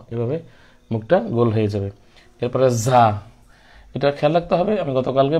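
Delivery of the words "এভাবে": ordinary talk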